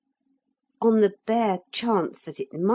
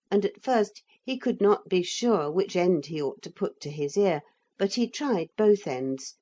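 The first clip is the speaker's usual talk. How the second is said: usual speaking voice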